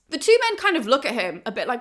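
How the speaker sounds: ordinary talk, nothing marked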